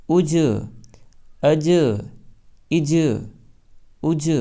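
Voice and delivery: each اج with a rebounding ج; bouncing qalqala. Shown as a demonstration. Teacher drilling students: bouncing اج. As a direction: bounce the ج of اج every time. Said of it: "اچھل جائے گی".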